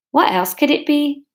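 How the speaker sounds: imperfect put-on Scottish accent; attempted Scottish accent. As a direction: try a rough Scottish accent on the question. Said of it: "distinguishable from the Scottish accent".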